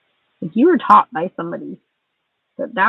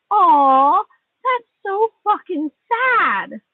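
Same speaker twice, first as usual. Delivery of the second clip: a loud "Aw" then a very slow, much higher sneer; mock sympathy; 11 semitones higher, 4 dB louder, much slower than usual. Real, not acted. To the friend who feels bad, slow mocking fake pity. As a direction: pretend to pity them, all scorn.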